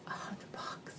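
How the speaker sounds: whispered repeat of the price. Shown as a demonstration. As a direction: drop to a whisper to stress the price. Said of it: "repeats price in a whisper".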